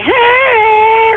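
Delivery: very high, drawn-out mock clown "hey"; imitated clown greeting. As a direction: mimic a waving clown in a high voice. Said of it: "Was he like".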